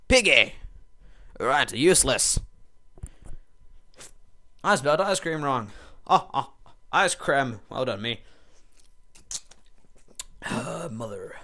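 normal talking voice

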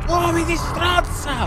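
slow high cry of despair; high frustrated wail. Real, not acted. Frustrated at game.

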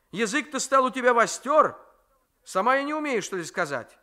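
put-on a grandmother snapping at a forward girl; scolding grandmother. Grandmother rebukes.

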